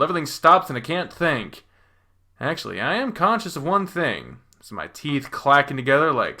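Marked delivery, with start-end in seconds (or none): none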